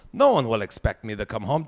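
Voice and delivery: ordinary talk, nothing marked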